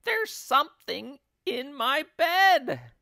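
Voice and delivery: high, loud panicked shriek; screamed. A narrator's reading. Wife bouncing about over thing in bed, screaming. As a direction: shriek it in alarm. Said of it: "screamed Missus Twit, bouncing about".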